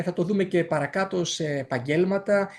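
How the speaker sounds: usual speaking voice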